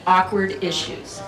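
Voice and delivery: ordinary talk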